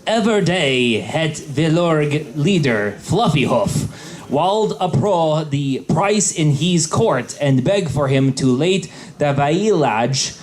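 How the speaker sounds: normal talking voice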